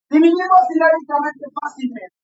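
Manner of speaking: ordinary talk, nothing marked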